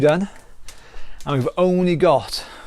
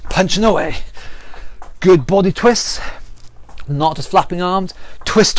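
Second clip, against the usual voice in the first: higher, pumped-up calls over the exercise; workout coaching calls; 4 semitones higher than usual. Acted. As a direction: cheer them through the round. Calling out during a workout round.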